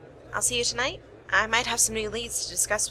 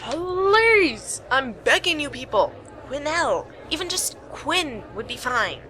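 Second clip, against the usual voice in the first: exasperated plea; 5 semitones higher, 3 dB louder, slower than usual. Acted. Exasperated plea about her name.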